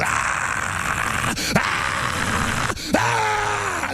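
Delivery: gasping then a high shouted ah; breathy gasps building to a yell; primal gasps rising to a shout. Real, not acted. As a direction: let the spirit cry out in bursts. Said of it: "my whole spirit just goes".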